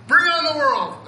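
bursting, high and loud; fired up. Retold or imitated. Speaker imitating himself after parents' call, fired up. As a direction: punch it out like you could take on anything. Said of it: "and I'm like".

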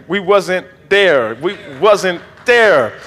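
very slow, with pauses before THERE; pointed repetition. Real, not acted. Preacher pointedly repeats it to the congregation, slow and deliberate. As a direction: drag out "wasn't there", word by word.

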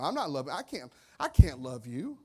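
usual speaking voice

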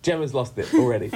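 words squeezed out through laughter; laughing. Real, not acted. Host laughing as Gemma fails the first word. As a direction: laugh your way through the line.

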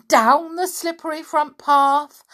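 ordinary delivery

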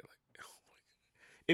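breathy hushed oh my God; mortified whisper. Retold or imitated. Host reliving silence on the store phone, whispering. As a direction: whisper it like you want to disappear.

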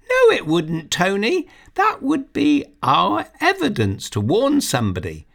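put-on a girl arguing her case; girl Sarah. Narrator voices girl Sarah.

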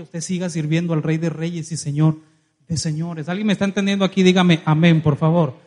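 loud preacher calling for amen; fiery pulpit call for an amen; preaching at full force. Acted. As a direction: rouse the congregation, shout it out. Preacher shouting to congregation.

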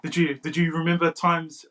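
usual speaking voice

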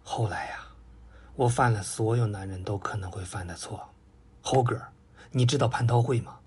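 a sorry sigh, half whispered; rueful pig owning up to a mistake; sighing confession. A narrator's reading. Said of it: "老朱是叹了口气".

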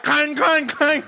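high mock clanks; imitating the car's noise; clanking car imitation. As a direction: clang like a rattling old car. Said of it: "and the car's like".

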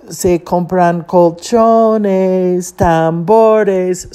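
slow, drawn-out vendor chant; street-vendor tape. Retold or imitated. Tape heard behind Cindy's phone call, drawn-out street call. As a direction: imitate a recorded hawker, stretching the words. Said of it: "you hear the tape".

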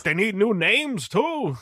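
slow, high, put-on complaint; mock annoyed dad. Dad disapproving of the ninja stuff, mock annoyance. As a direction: imitate a pissed-off dad, drawn out and rising.

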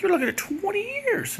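very high, hammed-up lawyer mock. Comedian repeats the lawyer's twenty-year warning in a very high mock voice. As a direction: squeak out the lawyer's dire warning. Lawyer mocked in retold story.

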